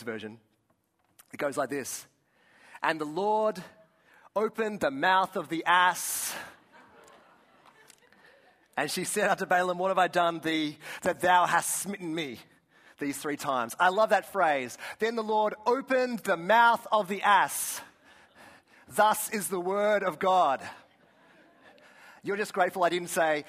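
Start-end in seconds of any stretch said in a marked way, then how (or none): 2.8-13.7 booming mock-solemn Bible reading; grand scripture proclamation. Reads King James Version with relish. 15.0-20.7 loud, high, drawn-out mock-solemn reading; grand scripture proclamation. Savours the archaic verse for laughs.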